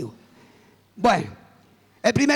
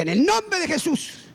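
slow, higher-pitched commanding rebuke; stern rebuke; 6 semitones higher, slower than usual. Retold or imitated. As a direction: rebuke it with stretched-out authority. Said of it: "reprendí a esa voz".

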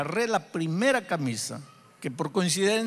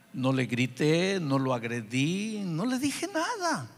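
indignant protest; slower than usual. Retold or imitated. Father answering the inner voice, protesting his innocence. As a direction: draw out each denial, rising into a protest. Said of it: "yo inmediatamente reaccioné y dije".

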